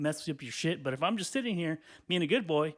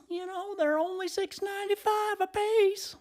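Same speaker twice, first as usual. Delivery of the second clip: put-on very high, slow little-old-lady voice; nice old lady; slower than usual. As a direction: imitate a sweet old sample lady in a thin high pitch. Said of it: "they have a little nice old lady go".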